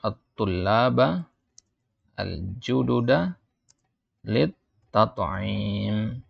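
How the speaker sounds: very slow, word by word; slow dictation. Shown as a demonstration. Teacher dictates the phrase slowly to students. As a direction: spell each word out for students. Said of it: "slow dictation to class".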